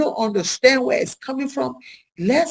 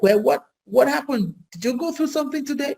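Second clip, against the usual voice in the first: concerned question; 6 semitones higher than usual. Retold or imitated. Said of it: "And it's just like".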